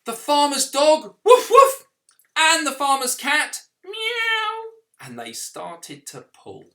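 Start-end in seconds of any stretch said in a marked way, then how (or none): 1.2-1.9 high, loud woofs; dog barking. Acted. Dog joins the rope. 3.8-4.7 high meow; cat meowing. Acted. Cat joins the rope.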